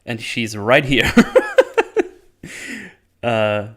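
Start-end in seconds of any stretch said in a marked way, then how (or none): 1.1-2.1 real laugh jumping high and loud; high burst of laughter. Real, not acted. Laughs after joke.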